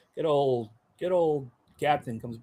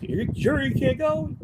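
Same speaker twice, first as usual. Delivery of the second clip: higher, pointed instructor question; mock instructor; 14 semitones higher, 4 dB louder than usual. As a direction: needle the quitting recruit. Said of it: "instructor's like".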